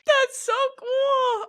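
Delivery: high, drawn-out squeal of delight; real high-pitched gushing; gushing excitement. Real, not acted. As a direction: squeal it slowly, thrilled for a friend. Friend gushing over the trailer news.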